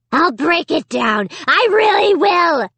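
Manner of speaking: slow then shrieking threat; threatening dummy. A narrator's reading. Slappy threatens Amy through the closet door. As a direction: pound out the threat, rising high and loud. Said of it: "he threatened".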